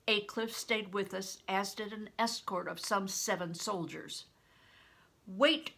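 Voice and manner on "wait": loud, higher call; cried out. A narrator's reading. Crispin crying out to the escort to wait. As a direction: call out suddenly to stop them. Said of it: "I cried".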